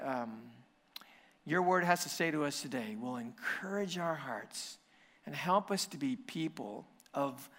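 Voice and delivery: quiet, earnest prayer voice; 轻声诚恳地祷告. Acted. Pastor opens with prayer.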